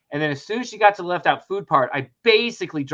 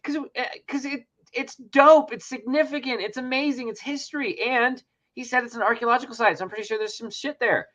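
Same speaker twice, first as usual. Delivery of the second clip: higher, eager gushing; gushing excitement; 8 semitones higher than usual. Retold or imitated. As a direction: gush like a superfan. Enthusing to the ranger.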